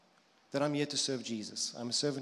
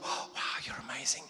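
hushed, breathy mock wonder; awestruck dog; 2 semitones lower, 3 dB quieter than usual. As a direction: whisper your adoration like a grateful dog. Voicing an awestruck, grateful dog.

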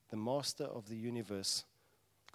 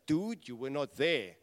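slow, firm, set-apart voice; slow knowing retort; weighty reply; 4 dB louder than usual. Acted. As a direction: lay it down like someone who saw it. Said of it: "And it's like".

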